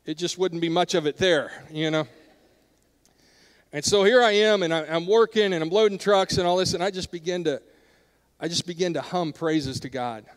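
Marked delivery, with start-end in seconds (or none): none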